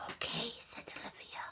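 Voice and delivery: very soft whispered okay; whispering. Acted. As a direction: whisper back obediently. Olivia agrees in whisper.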